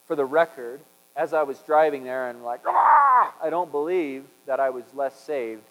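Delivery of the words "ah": sudden loud high yell of frustration; angry yell. Retold or imitated. Driver losing his temper at a missed turn, short angry yell. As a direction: burst out in a short shout. Retold outburst while driving.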